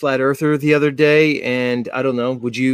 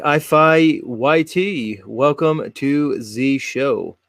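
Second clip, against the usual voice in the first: show intro; much slower than usual. Acted. Host's show intro announcement.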